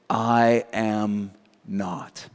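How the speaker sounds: very slow, low reply; measured denial. Retold or imitated. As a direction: deny it word by word, low and drawn out. Peter's first denial acted out.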